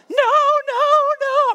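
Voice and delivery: mock hysterical high, loud wail; hysterical child. Dad imitating his son facing the wooden spoon, hysterical. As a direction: imitate a panicking kid, voice shot way up and loud. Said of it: "he just starts going hysterical".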